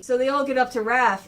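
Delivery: usual speaking voice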